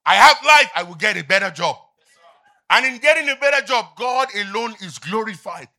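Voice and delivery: loud and high proclamation; fervent preaching. Acted. Believer answering the doubter, loud fervent declaration. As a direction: declare it with fire.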